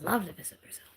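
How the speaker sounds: breathy whispered aside. Real, not acted. Whispering her wish to visit Brazil. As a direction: whisper it like a secret.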